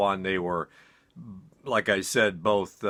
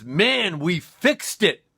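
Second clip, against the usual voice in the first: slow, higher, lightly voiced stoned buddy; stoned roommate; 8 semitones higher, slower than usual. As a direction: drag it out like a proud stoner. Stoned roommate announces the fix.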